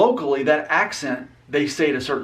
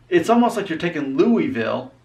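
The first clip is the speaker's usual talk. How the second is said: ordinary talk, nothing marked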